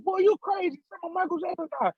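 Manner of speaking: high-pitched mimicked disbelief; comic high female mimicry; retold scolding lady. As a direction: tell him off as an amused lady. Lady scolds him again.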